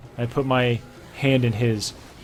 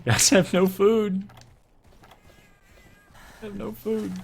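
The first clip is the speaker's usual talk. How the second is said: mock falsetto whine; 11 semitones higher than usual. Reader's mock aside in falsetto.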